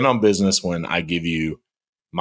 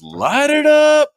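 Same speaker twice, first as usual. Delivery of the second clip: high, loud slow call; mock MC shout; 7 dB louder, slower than usual. MC calling for the lights, shouted. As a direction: call it through a mic again. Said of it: "the M C with the microphone again goes".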